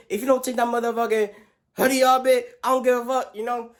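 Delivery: high, loud, half-joking yelling at a friend; playful mock fury; mock angry rant. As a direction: yell at him to take the hoodie off. Angrily telling Manor to remove hoodie.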